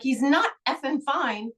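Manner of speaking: mock outraged protest, leaning on "not"; angry retort. Retold angry retort to doctor.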